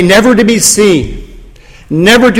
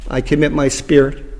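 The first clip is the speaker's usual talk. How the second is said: softer, breathed out, fading to nothing; breathed last words; 4 semitones lower, 7 dB quieter than usual. Retold or imitated. The dying Son gives up his spirit, softer and breathed. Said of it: "he has breathed out his last words".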